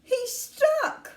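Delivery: high, anxious, halting; high-pitched mounting concern; worried boy. A narrator's reading. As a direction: watch in alarm, pitch climbing. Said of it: "said Charlie".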